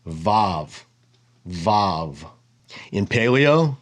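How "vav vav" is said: "Vav" said lower with a firm V; careful Vav demo. Shown as a demonstration. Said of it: "But it's very simple".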